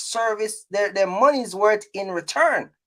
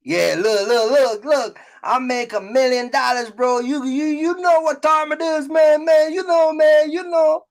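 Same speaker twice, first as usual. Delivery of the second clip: mock braggart; 4 semitones higher than usual. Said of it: "the perception that they've come and put".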